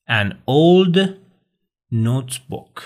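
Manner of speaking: put-on teacher stressing "an" before "old". Shown as a demonstration. Teacher showing students an before a vowel. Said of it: "من باید بگم an".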